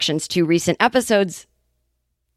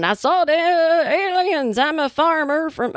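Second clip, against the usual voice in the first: high, drawn-out Southern farmer; mock Southern yokel, pitched up; 8 semitones higher, slower than usual. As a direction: put on a hammy Southern accent. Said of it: "I'm doing the Southern voice".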